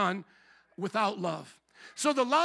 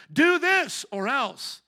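slow, higher, louder "Do this or else"; stern ultimatum; 5 semitones higher, 6 dB louder, much slower than usual. Retold or imitated. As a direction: lay down the ultimatum word by word. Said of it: "give ultimatums".

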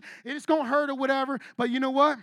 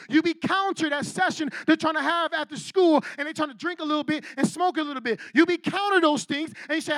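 building preacher's exhortation, louder; fiery sermon push; preaching; 3 semitones higher, 4 dB louder than usual. Acted. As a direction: preach it, rising in force.

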